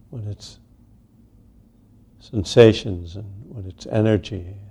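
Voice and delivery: unhurried meditation guidance with long pauses; guided meditation. Real, not acted. Teacher settling a group into sitting meditation, unhurried guidance. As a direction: ease each phrase out, leaving space after it.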